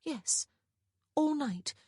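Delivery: high, level-volume confirmation; confirming answer. A narrator's reading. Answers her sister's shocked question.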